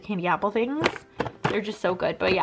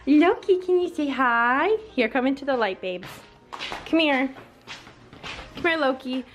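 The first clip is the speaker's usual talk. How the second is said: higher-pitched cooing to a pet; coaxing pet talk; 4 semitones higher than usual. Real, not acted. Vlogger calls Loki over to say hi, high coaxing pet voice.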